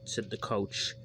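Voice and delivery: ordinary delivery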